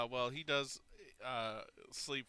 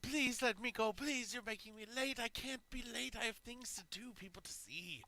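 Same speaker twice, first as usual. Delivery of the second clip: high, whiny begging; high pleading captive; 11 semitones higher than usual. Acted. Prisoner pleading with captors to let him go, high whine. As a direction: beg to be freed, voice pitched high.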